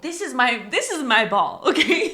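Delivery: loud protest breaking into laughter; hammed-up, high, laughing objection; mock indignation. Voicing indignant character, laughing.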